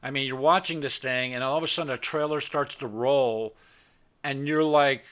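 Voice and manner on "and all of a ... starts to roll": normal talking voice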